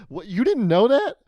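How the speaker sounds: high, loud mock disbelief; acted-out incredulous outburst. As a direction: throw back an astonished question. Acted incredulous reply to kid.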